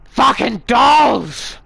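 slow, loud furious outburst; drawn-out angry curse. Real, not acted. Gamer cursing the dolls after a scare, furious. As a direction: spit out the curse, stretched long.

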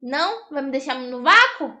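normal talking voice

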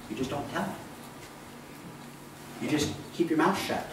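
ordinary talk, nothing marked

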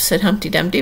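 usual speaking voice

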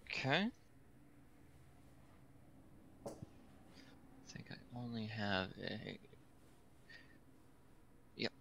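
half-whispered aside to the table. Real, not acted. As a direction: hush it to the group, unvoiced. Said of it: "give me a listen check".

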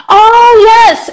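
high, loud burst of realisation; light up as the memory suddenly clicks; drawn-out, high and loud exclamation; delighted recognition. Real, not acted. Suddenly remembers who the viewer is.

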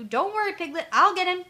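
high, loud burst of bravery; roused and ready to pounce; bold rallying. Acted. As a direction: jump to a friend's rescue. Pooh leaps up to fight heffalump.

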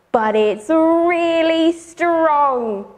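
slow and heavy, each word leaned on; slow emphatic. Acted. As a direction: drag it out, make it sound mighty. Storyteller stresses strength.

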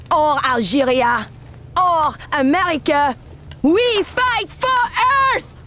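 slowing, rising build to a battle cry; stirring speech building to a climax; rallying cry. Acted.

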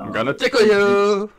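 high playful tickle threat; giggly tickle attack. Pretend.

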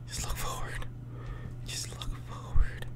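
soft, voiceless self-talk; whisper. Real, not acted. Player tells self not to look back, whispering. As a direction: whisper it to steady yourself. Coaches self in scary corridor.

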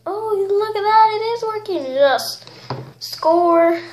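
high, loud burst of delight; excited cheer. Real, not acted. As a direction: cheer like it finally worked. Slime suddenly works, triumphant.

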